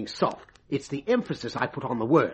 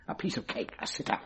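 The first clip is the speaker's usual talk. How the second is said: sly aside; 4 semitones lower, 10 dB quieter, faster than usual. Acted. Coaxing stooge, sly aside.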